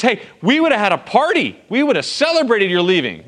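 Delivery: mock indignant Laban. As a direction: act out Laban's fake hurt. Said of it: "So Laban says".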